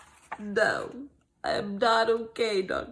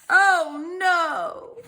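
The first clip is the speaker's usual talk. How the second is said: high, louder alarm; 5 semitones higher, 6 dB louder than usual. Acted. Gerald crying out in dismay to Piggy. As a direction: cry out in dismay.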